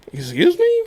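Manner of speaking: high startled question; indignant high excuse me; shocked. Retold or imitated. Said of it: "I was like".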